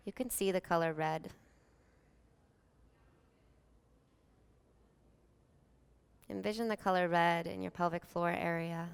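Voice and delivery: calm, unhurried meditation voice with long pauses; soothing yoga nidra guidance, evenly paced; guided meditation. Real, not acted.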